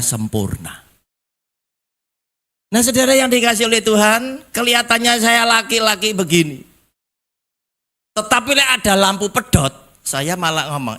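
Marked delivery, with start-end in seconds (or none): 2.7-9.7 high, ringing preacher's appeal; full-force preaching. Real, not acted. Preacher urging the congregation to ask, high and insistent. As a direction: pitch it high to rouse the congregation.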